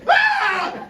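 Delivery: sudden high, loud fake scream of pain; mock scream. Friend pretending his hand came off in front of the kids, screaming high. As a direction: shriek high like he's just lost the hand. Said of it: "He goes".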